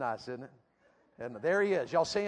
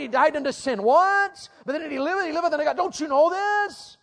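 higher and louder, building to a shout; building urgent preaching; 9 semitones higher, 8 dB louder than usual. Acted. Preacher challenging a youth crowd on the verses, building to a shout. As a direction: press each question harder, voice climbing.

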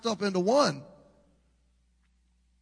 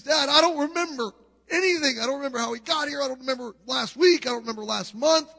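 panicked son; 8 semitones higher, 5 dB louder than usual. Acted. Son panicking after head injury.

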